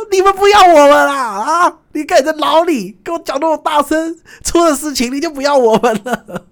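high, loud and slowed complaint; hammed-up protest at the embassy staff, high and loud; mock indignant yell. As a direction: drag out a wounded accusation. Yelling at embassy people.

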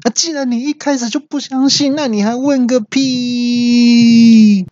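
very high, strained outburst with a long drawn-out last word; exasperated yell. Acted. Voice assistant snapping at the user who doubts its forecast, exasperated high yell. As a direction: cry out high in exasperation, stretching the end. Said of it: "语音就大叫说".

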